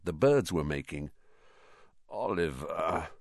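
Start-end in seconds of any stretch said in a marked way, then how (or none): 2.1-3.1 soft sleepy croak; croaky. A narrator's reading. Harry, woken early, protests to Oliver in a soft croak. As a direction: croak it out, barely awake. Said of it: "Harry croaked".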